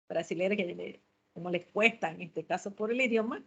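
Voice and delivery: ordinary delivery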